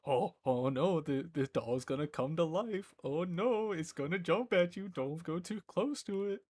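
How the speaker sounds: goofy fake panic at a scary film; mock scared. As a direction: overplay fear of the doll. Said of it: "I was like".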